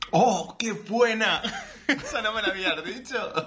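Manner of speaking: helpless laughter through the words; real laughing delight. Real, not acted. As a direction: crack up while saying it. Cracking up at a joke name.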